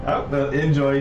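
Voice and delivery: normal talking voice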